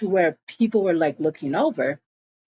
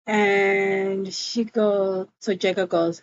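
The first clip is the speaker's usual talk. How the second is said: normal talking voice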